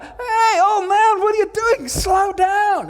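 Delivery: high, loud heckling from onlookers; shouting out. Acted. Onlookers yelling at an old man running past, shouting. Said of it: "they're shouting out".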